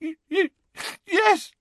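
very high, stammered gasp; hammed-up breathless sob. A narrator's reading. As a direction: catch on the first sound. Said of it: "gasped Hermione".

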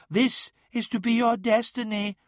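high prophetic voice; ominous mirror daemon. A narrator's reading. Mirror shows Magnus his destiny, ominous and high. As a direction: pronounce a fate, pitched high.

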